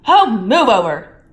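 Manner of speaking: loud, slow impatient snarl. A narrator's reading. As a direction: shove past him, fed up. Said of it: "Hermione snarled".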